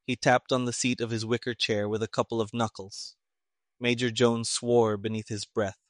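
ordinary delivery

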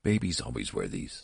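a touch of prim correctness; prim nurse. A narrator's reading. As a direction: say it primly. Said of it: "said the nurse primly".

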